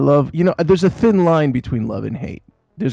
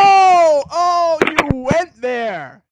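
mock shocked; 13 semitones higher, 4 dB louder, much slower than usual. Real, not acted. Reacting to caller insult.

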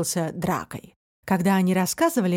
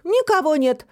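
higher, pointed declaration; deliberately loud voice; 7 semitones higher, 3 dB louder than usual. A narrator's reading. Фантик tells Тусик for eavesdropper, pointedly loud. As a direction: announce it for a hidden listener. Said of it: "сказал специальным громким голосом".

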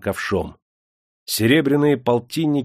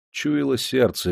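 plain reading voice

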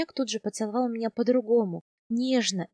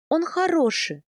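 higher, insistent protest; indignant outburst; 7 semitones higher, 4 dB louder than usual. A narrator's reading. Heroine defends her husband to the khan, insistent outburst. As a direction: jump up in pitch to defend him. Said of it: "не выдержала я".

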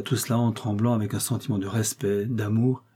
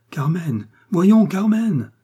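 high, loud, drawn-out call; desperate calling, much higher and louder; 8 semitones higher, 6 dB louder, much slower than usual. Acted. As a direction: call out to her, louder each time. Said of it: "Il appela encore plus fort".

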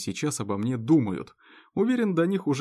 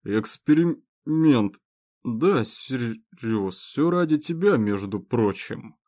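put-on town drunk; slower than usual. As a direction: drag out each word like a drunk. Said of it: "Заплетающимся языком Совух".

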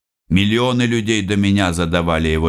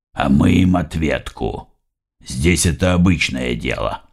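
lower, slightly gruff boss talking business; 7 semitones lower, slower than usual. Acted. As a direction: voice Sam lower and heavier. Boss Sam explains plans.

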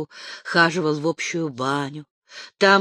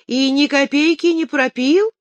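mocking smirk; 9 semitones higher, 6 dB louder than usual. A narrator's reading. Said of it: "усмехнулся Самсоний".

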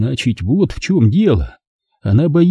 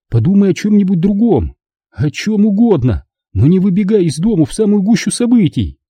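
higher, urgent mother's voice; pleading mother; 6 semitones higher than usual. Acted. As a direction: plead with him as his mother. (мать умоляет сына, высоким голосом)

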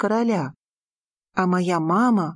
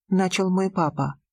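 usual speaking voice

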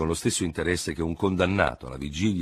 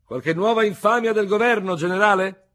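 much higher, loud call across the café; shouted question; 8 dB louder than usual. Acted. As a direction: yell it from the far end of the room. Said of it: "gridò dall'altra estremità del caffè".

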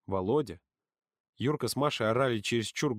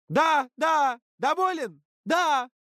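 very high, loud yells; high-pitched, furious outburst; exasperated shouted confession; 6 dB louder, much slower than usual. A narrator's reading. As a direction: scream it out to end the questioning.

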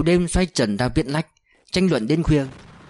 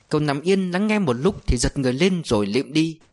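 plain reading voice

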